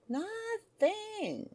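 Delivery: high drawn-out nothing. Real, not acted. Sing-song disappointment over search.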